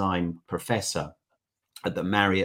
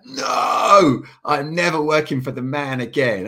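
defiant declaration; 4 semitones higher, 6 dB louder, slower than usual. Retold or imitated. Imagined post-trip resolve quote.

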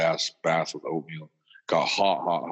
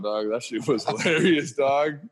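usual speaking voice

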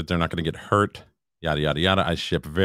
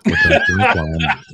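laughing; 5 dB louder than usual. Real, not acted. Laughing mid-line.

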